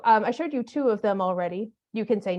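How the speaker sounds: ordinary talk, nothing marked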